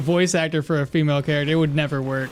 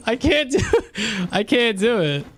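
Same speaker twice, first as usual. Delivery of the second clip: laughing; 5 semitones higher than usual. Real, not acted.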